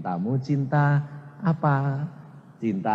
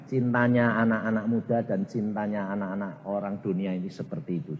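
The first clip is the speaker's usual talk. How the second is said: ordinary talk, nothing marked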